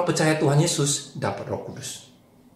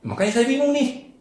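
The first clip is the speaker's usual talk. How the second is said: put-on much higher, louder boy's voice; high confused child; 13 semitones higher, 5 dB louder than usual. Rudi tells Anton he is confused, high child voice. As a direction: squeak it out confused.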